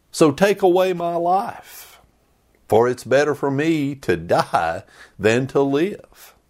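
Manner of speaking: hammed-up wish to die; mock melodramatic prophet; dramatic outburst. Jonah's despairing outburst.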